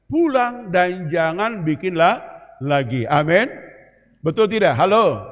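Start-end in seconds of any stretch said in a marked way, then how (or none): 0.1-3.0 slow, emphatic. Shown as a demonstration. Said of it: "hargai perkataan kedua tadi".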